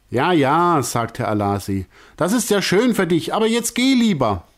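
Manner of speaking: high, impatient brush-off; dismissive. A narrator's reading. Director brushing off Veronika, high and impatient. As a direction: wave her off impatiently.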